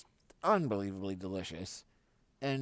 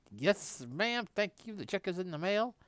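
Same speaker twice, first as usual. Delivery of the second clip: higher, overly polite thanks; mock polite thanks; 9 semitones higher, 3 dB louder than usual. Speaker thanking the old woman for the appetizer, higher mock-polite. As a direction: play the gracious guest with a lifted voice. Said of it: "I was like".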